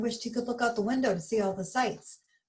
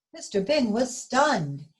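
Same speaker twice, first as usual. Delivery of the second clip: ordinary delivery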